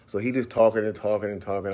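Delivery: ordinary talk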